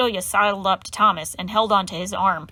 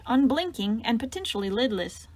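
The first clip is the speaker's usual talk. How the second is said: usual speaking voice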